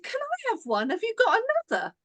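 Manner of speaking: imitating the women, high and fast; squeaky, rushed, eager asking; eager stranger. Two women in the queue begging for a card, high and eager.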